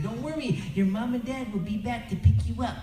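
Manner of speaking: reassuring caseworker. Retold or imitated.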